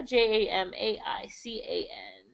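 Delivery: quietly checking her own spelling; whispered spelling. Real, not acted. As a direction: whisper each letter under her breath. Spelling it out under her breath.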